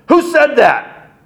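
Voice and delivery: high, loud, slow "Who said that?"; sharp demand. Retold or imitated. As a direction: bellow at the crowd to find the culprit. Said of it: "Khrushchev immediately said".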